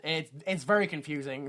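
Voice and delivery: normal talking voice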